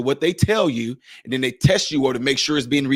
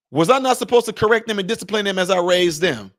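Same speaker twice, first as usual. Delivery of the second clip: ordinary talk, nothing marked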